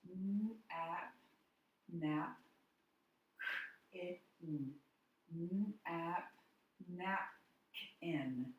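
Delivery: napkin sounded out slowly, syllable by syllable; sounding out. Shown as a demonstration. Teacher sounding out a word for the class. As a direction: break napkin apart and blend it slowly. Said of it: "So let's read the word".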